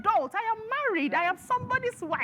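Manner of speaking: high-pitched, worked-up protest; heated outburst. Real, not acted. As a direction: say it like you're fed up and insisting. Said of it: "Daddy, don't mind her".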